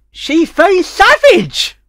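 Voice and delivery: high, loud shocked cry of disbelief; outraged exclamation. Real, not acted. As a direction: shriek it in mock outrage. Exclaims about Applejack burning barn.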